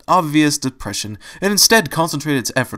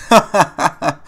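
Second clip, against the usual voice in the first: loud, high hearty laugh; chuckle; 6 semitones higher, 6 dB louder than usual. A narrator's reading. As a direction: laugh out at the sleeping friend. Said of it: "chuckled the intruder".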